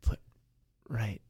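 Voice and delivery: low seductive murmur. Pretend. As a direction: tease him with a hushed voice. Headphone chicken, seductive low voice.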